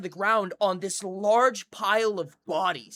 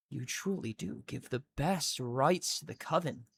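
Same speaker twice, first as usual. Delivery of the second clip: purring witch; 5 dB quieter than usual. Acted. Witch taunting the captured hero, soft and sly. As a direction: play the sly witch savouring her prize.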